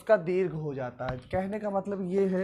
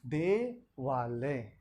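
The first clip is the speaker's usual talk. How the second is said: slow, drawn-out word; 6 semitones lower, 6 dB quieter, much slower than usual. Shown as a demonstration. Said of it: "तो इस तरह से आपका बन गया".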